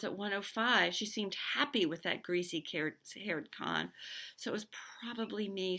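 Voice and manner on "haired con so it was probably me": ordinary delivery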